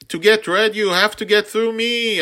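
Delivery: very high, loud defiant shout; girl's battle shout. A narrator's reading. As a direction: yell a challenge at the swarm. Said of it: "I heard her shout".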